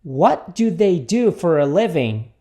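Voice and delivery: slow but connected question; repeat-after-me model. Shown as a demonstration. Teacher repeats the question for students, slow and connected. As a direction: link the words at a measured pace. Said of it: "Repita comigo".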